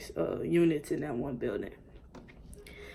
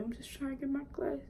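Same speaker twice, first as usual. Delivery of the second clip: put-on meek kid voice; 9 semitones higher, 5 dB quieter than usual. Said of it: "I'm just like".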